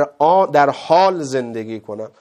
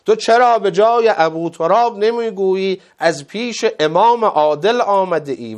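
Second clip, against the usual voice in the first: raised, higher, indignant challenge; zealous defence; 5 semitones higher, 5 dB louder than usual. Retold or imitated. Old man challenges the insulter for not naming the just Imam, zealous protest. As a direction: demand it in fervent retold protest.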